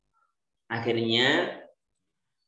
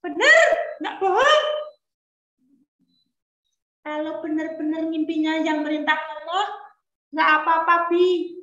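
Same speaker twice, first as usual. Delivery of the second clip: put-on high, curious child's voice; a boy pestering his father with questions; 13 semitones higher, 3 dB louder than usual. Young Ismail asking his father about the dream in a high child voice.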